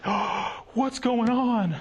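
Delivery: the pastor imagined being blindsided, mock shock; mock surprise. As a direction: act caught off guard, hammed up. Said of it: "He wasn't like".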